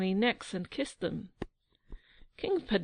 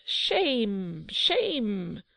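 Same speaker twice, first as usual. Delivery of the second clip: crowd crying shame. A narrator's reading. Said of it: "cried the crowd".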